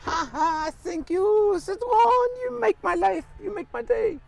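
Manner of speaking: put-on high, loud hammy French accent; fake French accent. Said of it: "How's my fake French accent going?".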